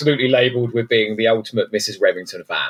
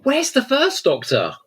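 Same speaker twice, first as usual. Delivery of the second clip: indignant surprise; 12 semitones higher than usual. Real, not acted. Indignant question about missing Doctor.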